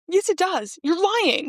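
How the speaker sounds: high-pitched, laughing protest; amused contradiction. Real, not acted. Co-host disputing the book recap, high laughing protest.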